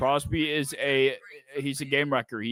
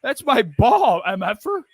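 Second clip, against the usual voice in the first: hammed-up tough guy claiming the ball; mock tough guy; 8 semitones higher, 5 dB louder than usual. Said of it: "and said".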